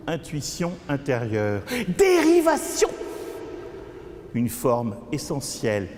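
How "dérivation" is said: high ringing outburst; sudden high-pitched poetic exclamation; sharp interjection. Acted. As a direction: fire the word out high. Interjection within poem.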